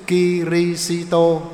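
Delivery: "Kitô" drawn out as Ki-ri-xi-tô on a level note; slow, chant-like Ki-ri-xi-tô; old-style pronunciation. Shown as a demonstration. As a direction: intone "Kitô" slowly in four syllables. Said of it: "Chữ Kitô".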